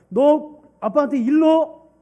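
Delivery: loud, high, slow threat from the neighbor; threatening neighbor. Acted. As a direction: bellow it slowly to scare the child.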